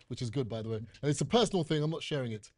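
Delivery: normal talking voice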